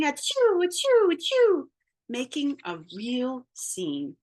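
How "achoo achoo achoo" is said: mock sneezes. Wolf sneezes repeatedly.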